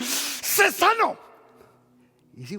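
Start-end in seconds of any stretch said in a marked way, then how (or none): 0.0-1.2 healing command. Acted.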